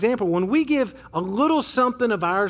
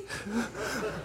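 laugh; 13 dB quieter than usual. Real, not acted. Laughing in lobby story.

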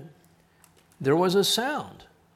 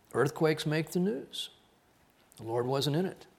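ordinary delivery

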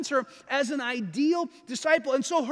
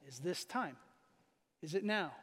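much softer, lower, half-whispered question; hushed plea; 8 semitones lower, 12 dB quieter than usual. Retold or imitated. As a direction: ask the father quietly, in awe. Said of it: "he's really asking his father".

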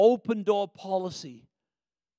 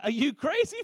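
excited disbelief; 7 semitones higher than usual. Acted. Father welcoming son back.

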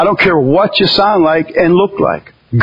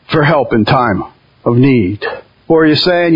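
normal talking voice

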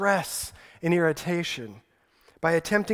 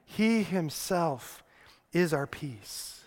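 soft echo; 7 dB quieter, slower than usual. Shown as a demonstration. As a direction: let the verse settle quietly. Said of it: "repeated after reading".

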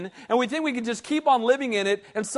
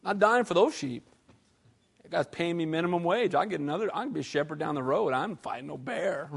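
muttering hireling; 7 semitones lower, 8 dB quieter than usual. Retold or imitated.

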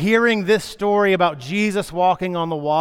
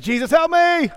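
much higher, louder playful panic; mock cry for help; 13 semitones higher, 4 dB louder than usual. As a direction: ham up a sinking Peter. Peter sinking, retold comically.

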